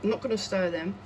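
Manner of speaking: muffled behind a respirator; talking through a face mask. Real, not acted. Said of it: "sorry, the mask, respirator is sliding up my face".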